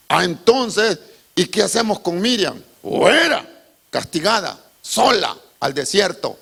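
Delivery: loud, high sermon delivery; fiery preaching. Acted. Preacher telling the congregation Miriam's punishment, loud fiery preaching. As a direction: preach it with full force.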